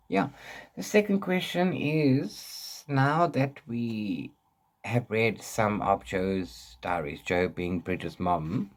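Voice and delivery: ordinary talk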